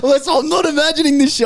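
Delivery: laughing disbelief. Retold or imitated. Retold shock, high and laughing.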